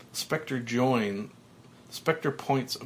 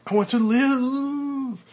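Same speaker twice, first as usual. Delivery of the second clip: high, loud, slow plea; drawn-out scream; 13 semitones higher, 7 dB louder, slower than usual. Acted. Frank bursting out of the elevator, slow high scream. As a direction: stretch the scream out.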